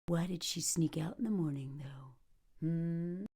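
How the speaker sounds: suspicious whisper. Real, not acted. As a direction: hush it like a detective. Suspicious aside.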